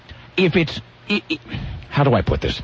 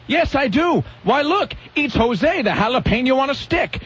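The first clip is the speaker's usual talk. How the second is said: mock showman ventriloquist; 7 semitones higher than usual. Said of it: "the guy's like".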